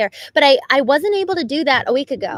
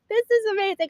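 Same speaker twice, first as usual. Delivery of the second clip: gushing delight; 10 semitones higher than usual. Retold or imitated. Blissful joy while driving.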